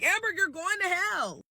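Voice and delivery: high-pitched curse; shrieked curse. Retold or imitated. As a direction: hurl it at the niece. Sarah yelling after slap.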